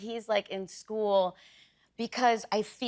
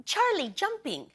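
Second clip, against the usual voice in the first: put-on slow, higher little-kid word pairs; lightly voiced toddler; 7 semitones higher than usual. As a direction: say it like a baby learning words. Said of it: "he pairs words".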